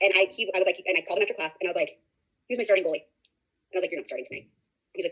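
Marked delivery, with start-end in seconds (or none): 3.9-4.5 low, soft firm verdict; retold stern coach. Coach benching the starting goalie after class, quietly stern. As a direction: lay down the penalty quietly and low.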